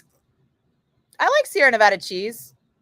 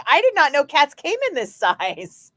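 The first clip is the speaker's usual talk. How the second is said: higher, louder astonishment with a laugh; amazed; 8 semitones higher, 4 dB louder than usual. Retold or imitated. Retelling first seeing a Maine Coon, amazed. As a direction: marvel at the huge cat. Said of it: "And I was like".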